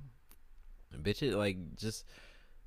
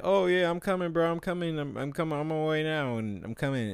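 high-pitched, louder eager mock reassurance; flaky friend's promise; 8 semitones higher, 4 dB louder than usual. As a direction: imitate the friend who swears he's on his way.

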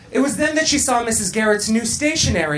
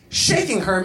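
ordinary delivery

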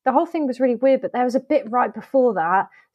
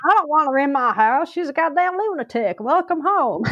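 higher, ranting mock-angry voice; put-on character voice; 4 semitones higher than usual. Joking that the mate's husband voices the house, mock angry rant. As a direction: play an indignant householder ranting.

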